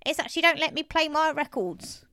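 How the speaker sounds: high-voiced old man complaining; Mister Braithwaite. A narrator's reading. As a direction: grumble like an aggrieved elderly man. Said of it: "said Mister Braithwaite".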